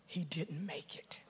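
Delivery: barely voiced whisper, slow. Widow repeating the doctor's news, in a whisper. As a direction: breathe it out like the news itself.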